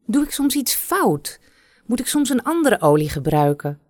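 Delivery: hoge, radeloze stem van de prinses; wanhopige prinses. Acted. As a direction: smeek om raad, vertwijfeld. Prinses vraagt kruidenvrouw wanhopig. (prinses vraagt de kruidenvrouw vertwijfeld om hulp, hoog en radeloos)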